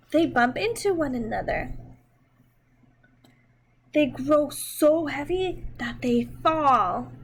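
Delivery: slow, expressive storybook reading; reading to children. Acted. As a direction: read it gently to little kids, taking your time. Reads picture book to children.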